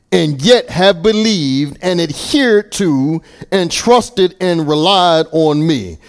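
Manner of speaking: preacher lifting a Bible passage; scripture reading. Acted. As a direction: read the verse out to the congregation with conviction. Reads the Amplified Bible aloud.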